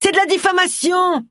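high, indignant outcry; yelling. A narrator's reading. Roy keeps protesting at the angry fisherman, yelling. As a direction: cry out in outrage, voice pitched high. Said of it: "hurla Roy".

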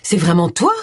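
mildly amazed question, lifting at the end; astonished. A narrator's reading. Woman to her ex-husband, astonished. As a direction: ask it as if unsure it is true. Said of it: "fit-elle d'une voix étonnée".

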